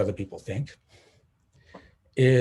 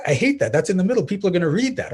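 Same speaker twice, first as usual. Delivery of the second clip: higher, louder imitation of the fretting partner; upset partner; 7 semitones higher, 6 dB louder, faster than usual. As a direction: act out his distress over the bad comments. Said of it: "And he's just like".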